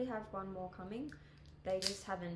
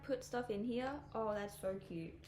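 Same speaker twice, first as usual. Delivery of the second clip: ordinary talk, nothing marked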